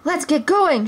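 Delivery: high, eager shout to flee. Acted. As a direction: urge your friend to run. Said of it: "shouted Robin".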